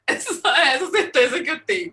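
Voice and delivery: real laughter running through the words; laughing self-mockery. Real, not acted. Artist joking about her only certainty, laughing.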